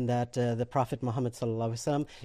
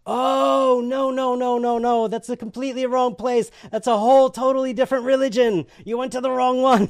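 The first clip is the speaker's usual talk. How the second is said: high, loud emphatic "no, no, no"; alarmed correction; 8 semitones higher, 6 dB louder than usual. Retold or imitated. Caller correcting the newcomer's mistake, alarmed and loud. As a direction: imitate an urgent, high-pitched objection.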